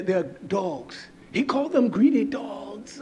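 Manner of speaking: ordinary delivery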